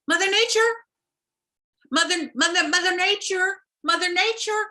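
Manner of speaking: high, insistent calls; calling out. Acted. Fish calling Mother Nature across the beach, high and insistent. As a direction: call her over and over.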